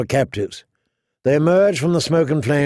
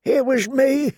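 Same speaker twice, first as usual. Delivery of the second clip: put-on old man; 11 semitones higher than usual. A narrator's reading. Old brother answers the archer about surviving, old man's voice. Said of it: "the old man said".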